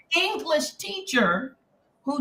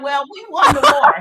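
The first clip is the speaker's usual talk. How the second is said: loud, higher comeback with a laugh; smart-aleck retort; 5 semitones higher, 8 dB louder than usual. Retold or imitated. Twelve-year-old Yankee answering her teacher, loud cheeky retort. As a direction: toss it back loud and cheeky. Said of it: "She called me a smart aleck Yankee".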